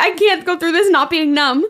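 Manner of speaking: high-pitched mock panic; retold nervous pleading. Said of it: "I just get nervous when people".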